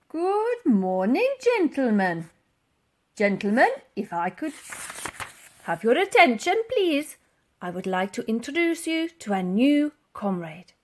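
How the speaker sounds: formal announcement. Acted. Titch formally addressing the ward.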